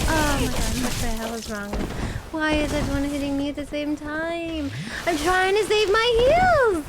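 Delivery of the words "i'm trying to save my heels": high and loud exasperated cry; frustrated yell. Real, not acted. Gamer swarmed by enemies, exasperated high yell. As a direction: yell it in distress. Everyone hitting her.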